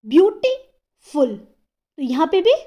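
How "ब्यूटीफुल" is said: Beautiful slowly split, gap after beauty; beautiful in two separated parts; pause mid-word. Shown as a demonstration. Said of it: "pause आता है".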